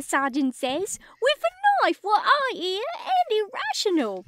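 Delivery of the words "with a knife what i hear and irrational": high, loud squeaky Cockney; hammed-up high Cockney; Cockney accent. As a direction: play the gossiping Cockney sergeant. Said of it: "I can't do a Cockney accent".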